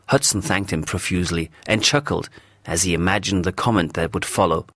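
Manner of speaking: plain reading voice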